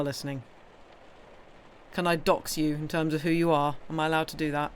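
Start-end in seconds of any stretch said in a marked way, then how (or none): none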